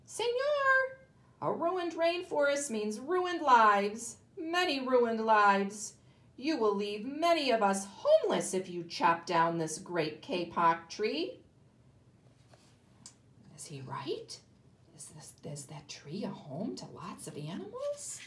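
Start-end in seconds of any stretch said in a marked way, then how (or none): 0.0-11.4 a tiny frog piping in his ear; squeaky frog. Acted. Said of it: "In a squeaky voice". 15.0-18.2 whisper. Real, not acted. Teacher whispers to class.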